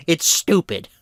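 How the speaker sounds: ordinary delivery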